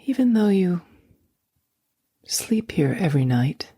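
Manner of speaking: ordinary talk, nothing marked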